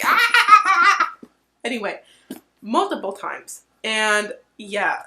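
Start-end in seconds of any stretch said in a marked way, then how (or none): 0.0-1.1 high, loud delighted shriek of shock; a sudden high squeal while laughing. Retold or imitated. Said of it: "I was just like".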